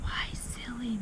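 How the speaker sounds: soft whispered question. A narrator's reading. Matilda questions Horatius on the playground, whispered. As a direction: ask it under your breath. Children whispering about the headmistress.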